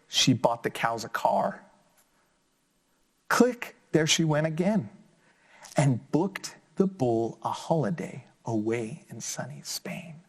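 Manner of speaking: put-on lively, sing-song story reading for kids; reading to children. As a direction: bring the picture book alive for little listeners. Reads picture book aloud.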